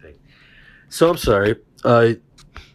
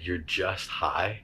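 calm reassurance; 7 semitones lower, 9 dB quieter, much slower than usual. Retold or imitated. Friend telling the panicking stoner, low and slow. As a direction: say it slowly and gently, like it fixes everything. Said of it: "the way he said".

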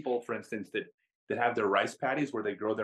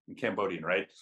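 usual speaking voice

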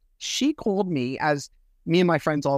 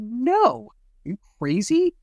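high-pitched, indignant comeback; retold incredulous denial; 8 semitones higher than usual. Retelling a denial under oath, incredulous and high. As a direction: act out an offended, disbelieving no.

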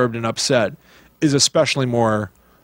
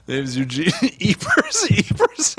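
giggling mock character intro; can barely get the name out for laughing; laughing through a mock intro; 8 semitones higher than usual. Host cracking up while introducing a made-up character.

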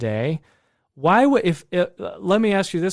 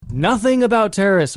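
microphone knocked while talking; mic bump; 6 semitones higher, 4 dB louder than usual. Real, not acted. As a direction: bump the mic mid-sentence. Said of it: "I keep hitting the microphone".

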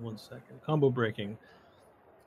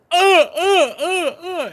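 high, loud repeated yelps; imitated cries; 7 dB louder, much slower than usual. Game master acting Bland getting kicked, imitated cries. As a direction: let out a string of high cries. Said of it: "Bland goes".

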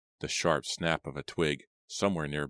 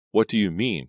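louder, higher, urgent question; demanding; 5 semitones higher, 7 dB louder than usual. A narrator's reading. Alarmed Simon questioning old Aggie, demanding. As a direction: demand an answer, voice raised. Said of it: "Simon demanded".

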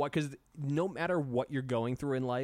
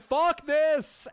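higher, louder fed-up yell; mock outburst; 11 semitones higher, 7 dB louder than usual. One man in the crowd, mock angry yell. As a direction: throw it all away in one shout. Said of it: "just one dude is just like".

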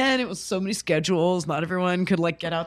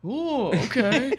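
mock guard; 4 semitones higher, slower than usual. Guard voiced agreeing.

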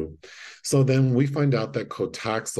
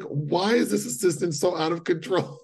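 high, bemused disbelief; incredulous; 9 semitones higher than usual. Retold or imitated. Viewer reacting to the out-of-control assistant, bemused. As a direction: ask it amazed and amused. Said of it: "And I'm just like".

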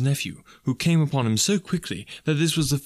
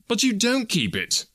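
high, incredulous protest; 11 semitones higher than usual. A narrator's reading. Nephew protests to his uncle.

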